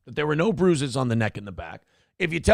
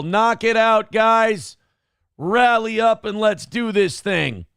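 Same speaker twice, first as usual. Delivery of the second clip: hype rally; 6 semitones higher, 4 dB louder, slower than usual. Real, not acted. Rallying donors to goal.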